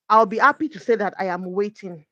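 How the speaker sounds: ordinary talk, nothing marked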